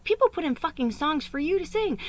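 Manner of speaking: retold exasperated rant, high and loud; going off on someone. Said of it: "kinda went off on me".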